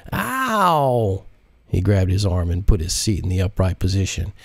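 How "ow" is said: short higher yelp of pain; a quick hurt "Ow!", higher. Acted. Jacinto punched in the shoulder, yelping in pain. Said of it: "He winced immediately".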